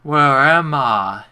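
loud, drawn-out cry of being lost; loud lost call. Real, not acted. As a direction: call it out loudly, stretching each word. Lost in game, calls it out.

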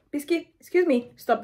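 high, loud call to stop; scolding the dog. Real, not acted. Owner stops her dog mid-video, high, loud scolding. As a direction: snap the dog out of it.

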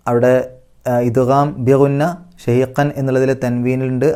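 usual speaking voice

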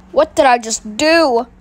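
ordinary delivery

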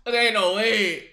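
usual speaking voice

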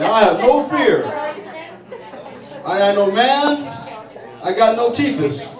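a hint of a fearless linebacker mother; lightly voiced bragging tough woman; tough mom. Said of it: "she'd probably say".

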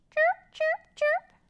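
high squeaky chirping; squeaky chicks. A narrator's reading. Librarian voicing the little chicks, squeaky. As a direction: peep like tiny baby birds. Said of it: "squeaked the little chicks".

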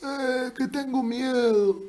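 very high, slow character voice; put-on voice. Shown as a demonstration. Streamer offers another voice for confessing fear, put-on voice. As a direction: stretch it out in a high, unnatural voice. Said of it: "puedes usar esa voz".

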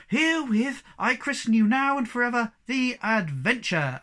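high, loud ceremonial declaration; proud proclamation. A narrator's reading. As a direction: proclaim it proudly to the whole crowd. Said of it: "Moomin Mama proudly proclaimed".

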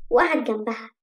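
plain reading voice

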